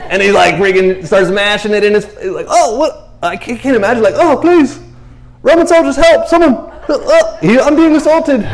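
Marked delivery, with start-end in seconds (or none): 2.5-3.1 much higher, startled oh what; a blind man jolted in surprise; startled yelp. Retold or imitated. 4.2-8.4 much higher and louder, hammed-up plea; mock cry for help. Blind man crying for help.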